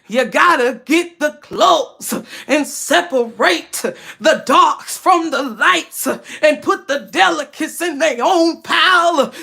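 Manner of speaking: slow, fervent church preacher voice; pastor voice. Retold or imitated. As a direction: preach the laundry like a sermon, slowly. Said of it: "I was playing the church voice, like, the pastor voice".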